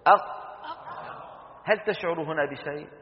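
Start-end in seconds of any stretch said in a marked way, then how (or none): 0.1-0.5 أق with the q held closed, no release; held stopped qaf. Shown as a demonstration. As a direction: stop the air on the ق of أق and hold it. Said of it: "لا تفلتها يا ولدي". (أق بحبس الهواء عند القاف دون إفلات)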